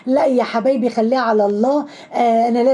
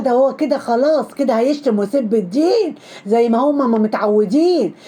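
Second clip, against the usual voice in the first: ordinary delivery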